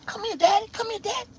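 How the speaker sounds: very high mock female voice; high-pitched come-on; woman beckoning. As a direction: imitate a woman coaxing a customer over.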